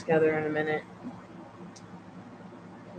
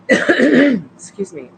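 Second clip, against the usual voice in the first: excuse me after burp; 6 semitones higher, 11 dB louder, much slower than usual. Real, not acted.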